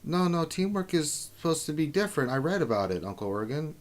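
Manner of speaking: put-on a young girl insisting earnestly. Niece insisting to uncle.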